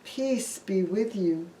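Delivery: low, soft and calm; calm Jesus. Acted. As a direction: speak peace gently to frightened friends. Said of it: "spoke to them calmly".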